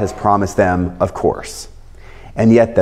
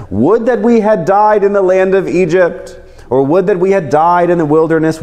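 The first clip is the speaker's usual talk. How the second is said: complaining crowd; 7 semitones higher than usual. Acted. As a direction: cry it out like a grieving crowd. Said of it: "The whole congregation said to them".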